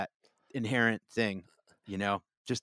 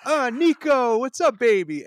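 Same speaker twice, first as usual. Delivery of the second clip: excited greeting; 11 semitones higher, 8 dB louder than usual. Retold or imitated. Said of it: "they're like".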